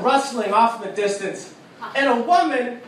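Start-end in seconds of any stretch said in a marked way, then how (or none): none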